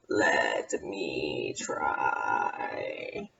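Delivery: very low, slow, breathy moan; deep mummy groan. Acted.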